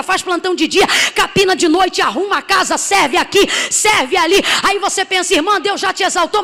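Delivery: loud, driving list of a single mother's day; full-force preaching. Acted. Preacher to congregation about a struggling mother, full-force preaching.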